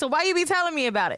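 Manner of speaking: high, quick sassy retort; letter writer's sassy comeback; sassy quote. Retold or imitated. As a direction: snap back with attitude. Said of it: "And I'm like".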